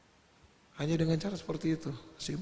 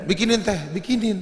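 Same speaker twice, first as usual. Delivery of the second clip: higher, louder wheedling plea for tea; playful coaxing; 7 semitones higher, 7 dB louder than usual. Retold or imitated. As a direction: coax mum into making tea, playfully.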